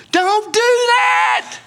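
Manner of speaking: high, drawn-out shout; Joseph protesting. Acted. Joseph shouting at his father to stop, high and slow. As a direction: cry out to stop him, stretching every word.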